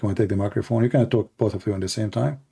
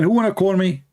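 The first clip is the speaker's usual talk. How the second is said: calling out; 7 semitones higher than usual. Retold or imitated. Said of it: "I lost my voice saying".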